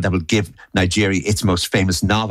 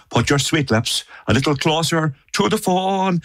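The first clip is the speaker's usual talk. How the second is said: put-on Irish accent; 6 semitones higher than usual. Showing why the lyric sounds wrong sung at home, Irish accent. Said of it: "what other way are you meant to do it?".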